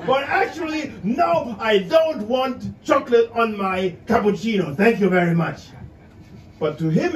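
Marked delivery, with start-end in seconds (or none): 0.1-5.6 higher, gushing polite refusal; a hint of over-polite English manners; overly polite refusal. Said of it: "which to her meant".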